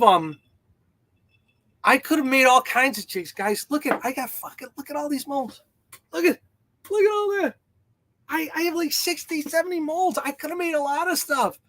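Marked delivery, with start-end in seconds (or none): 1.8-11.6 much higher-pitched, worked-up protest; exasperated protest. Real, not acted. Streamer justifying making only one jig to viewers, high-pitched exasperation. Exasperated, showing off many molds.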